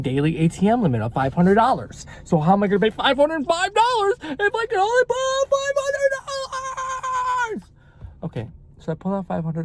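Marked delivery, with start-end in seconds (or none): 2.2-7.7 exasperated. Real, not acted. Exasperated at ATM limit.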